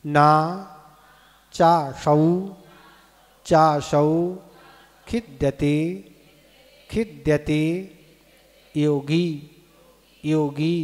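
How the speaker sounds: each word said separately, measured and deliberate; pronunciation demo. Shown as a demonstration. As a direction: sound out the Sanskrit for pupils to repeat. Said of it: "इसका उच्चारण करें". (एक-एक शब्द का स्पष्ट उच्चारण)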